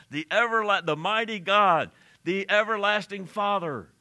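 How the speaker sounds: loud, high, ringing proclamation; choral crescendo. Retold or imitated. Preacher imitating the Messiah chorus at its peak, loud crescendo. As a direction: proclaim it like every voice of a choir at once. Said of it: "that noise with every".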